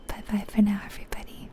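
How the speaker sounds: normal talking voice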